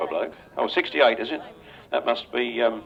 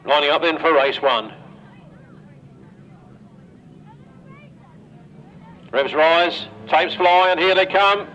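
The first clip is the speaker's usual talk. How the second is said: louder, higher play-by-play building as the tapes fly; live race commentary; 7 semitones higher, 6 dB louder than usual. Real, not acted. Announcer calling the race start to the crowd, excited play-by-play. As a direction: call the start as it happens, rising with the action.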